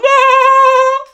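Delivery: very high, loud whoop of laughter; piercing, sustained high laugh; shrieking laugh. Acted.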